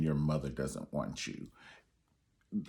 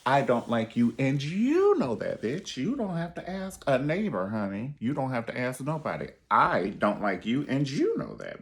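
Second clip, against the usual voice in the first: mock confrontation; 6 semitones higher, 4 dB louder than usual. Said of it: "Shanae told Gina".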